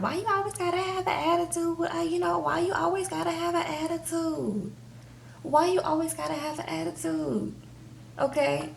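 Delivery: high, whiny imitation of a nagging wife; mock Andrea. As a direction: pitch it up and nag him. Recapping Andrea nagging Lamar.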